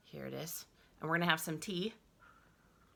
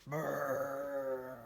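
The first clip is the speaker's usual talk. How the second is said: shivering brrr; 4 semitones lower, 3 dB quieter than usual. Acted. Shivering on bare feet.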